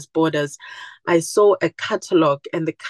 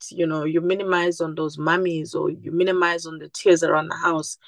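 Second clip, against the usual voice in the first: ordinary talk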